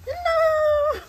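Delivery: squeaky hello. Acted. Greeting new room playfully.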